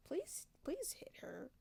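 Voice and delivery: hushed, slow pleading aside; slow, soft half-joking plea; quiet plea. Real, not acted. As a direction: beg softly and slowly. Begs during reading break.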